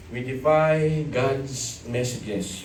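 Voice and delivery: slow emphatic. Acted. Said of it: "repeats phrase for emphasis".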